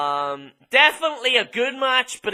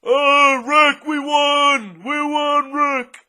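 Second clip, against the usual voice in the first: slow, loud, higher mannish shout; mocking Charlotte; 6 semitones higher, 3 dB louder, much slower than usual. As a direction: crow the victory like a muscleman. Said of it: "Charlotte sounds like a man".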